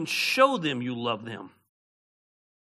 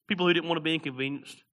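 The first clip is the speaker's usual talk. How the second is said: usual speaking voice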